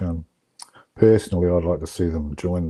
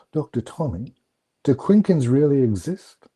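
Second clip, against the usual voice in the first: higher voice, drawn out to a questioning rise; son's wondering question; 6 semitones higher, 3 dB louder than usual. Retold or imitated. Son putting his question to Doctor Tommy, wondering. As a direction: imitate a boy carefully asking an elder.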